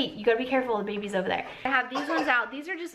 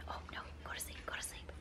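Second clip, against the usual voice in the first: breathy, soft shushing; whispering; 23 dB quieter, much faster than usual. Real, not acted. Soothing the sleeping baby.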